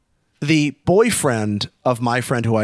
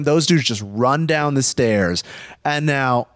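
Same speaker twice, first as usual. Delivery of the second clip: ordinary delivery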